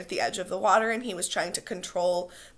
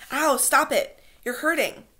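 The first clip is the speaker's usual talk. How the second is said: pained cry; 7 semitones higher, 5 dB louder than usual. Acted. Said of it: "then in real pain".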